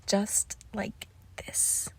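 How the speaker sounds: very soft and slow, close up; intimate murmur. Acted. Possessive lover holding their pet close, intimate murmur. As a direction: draw it out softly, almost whispered.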